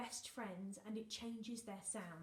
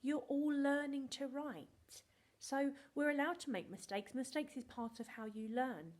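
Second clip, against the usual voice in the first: a touch of a firm, reassuring mum, higher and louder; mum's reassuring words; 6 semitones higher, 6 dB louder than usual. Retold or imitated. Teacher quoting her mum's encouragement, higher and louder. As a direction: gently put on mum's firm voice. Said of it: "But she said".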